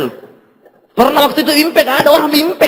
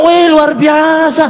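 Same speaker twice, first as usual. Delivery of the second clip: impressed praise; 5 semitones higher, much slower than usual. Retold or imitated. People praising him.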